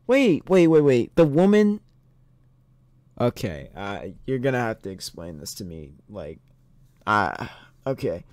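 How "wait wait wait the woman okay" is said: usual speaking voice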